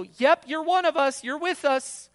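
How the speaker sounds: loud, high hollered approval; mock affirmation. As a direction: call back in hammed-up agreement. Acted false reassurance shouted back.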